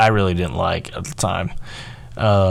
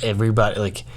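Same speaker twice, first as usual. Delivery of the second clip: ordinary delivery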